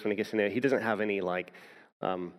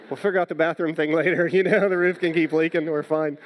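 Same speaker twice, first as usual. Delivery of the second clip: much louder, higher and fast with a laugh, retelling his joy; overjoyed; 7 semitones higher, 7 dB louder than usual. Retold or imitated. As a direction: gush with relief and delight. Retold overjoyed reply to friend.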